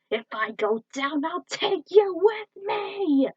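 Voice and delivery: high loud furious cries, slow threats; defeated villain rage. Acted. Eyespot raging at the troopers as his fortress burns. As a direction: rage and threaten as you fall.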